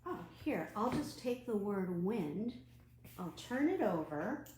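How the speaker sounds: ordinary talk, nothing marked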